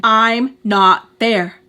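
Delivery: loud and slow, word by word; retold yell. Woman repeats herself to him on the phone, retold yell. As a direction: push every word out loudly. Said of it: "She yells at him again".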